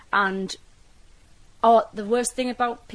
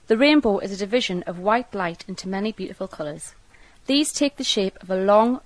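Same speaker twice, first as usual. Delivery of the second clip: careful, louder read of a set passage; reading aloud; 5 dB louder than usual. Acted. Reading the set passage for the archive, careful read-aloud. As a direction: read it out clearly like a dialect test.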